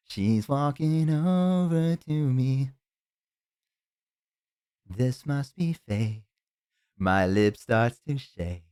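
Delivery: slow, wistful narrator voice drawn out; melancholy movie monologue. Pretend. Streamer imagining a sad prom scene, slow wistful voiceover. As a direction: play it like a lonely teen movie voiceover.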